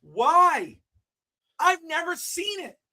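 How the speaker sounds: high, loud exasperated yelling; real outraged shout. Real, not acted. Host rants loudly about Shawn tossing photographer. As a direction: shout it in disbelief. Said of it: "stupidest thing".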